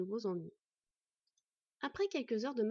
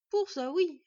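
forceful, higher and louder insistence; vehement; 7 semitones higher, 9 dB louder, slower than usual. A narrator's reading. Villager insists to the boy, vehement and loud. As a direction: hammer it home. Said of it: "avec véhémence".

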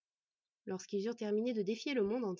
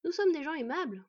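higher, loud mock politeness; 6 semitones higher, 7 dB louder than usual. Boy answering bandit chief, mockingly. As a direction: tease with breezy courtesy. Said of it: "confirma moqueusement Bord".

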